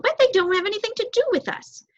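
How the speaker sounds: high, fast, fretful bird voice; anxious young crow. Acted. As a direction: hurry like a nervous youngster. Startled crow objects.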